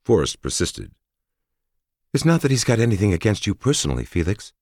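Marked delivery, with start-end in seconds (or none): 2.1-4.5 higher, earnest woman warrior; female soldier voice. Acted. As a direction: plead gently on his behalf. Narrator voices Forrest.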